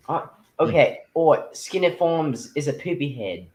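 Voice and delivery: put-on Australian accent. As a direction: put on the Aussie accent now. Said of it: "you got it there, mate".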